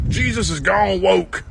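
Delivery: slow, sneering mock-conservative drawl; mock outraged voter. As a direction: sneer it like a scandalized churchgoer.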